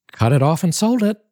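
Della, insistent. Acted. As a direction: say it quickly, pleading. Della's high voice.

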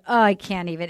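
ordinary talk

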